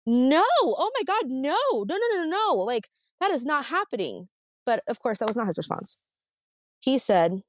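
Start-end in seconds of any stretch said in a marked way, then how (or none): 0.0-4.3 high, flustered denial, no, no, no; mock innocent denial. Wife mocking how innocent husband would react, high flustered. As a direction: play the shocked innocent man, pitch way up. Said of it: "someone innocent would be like".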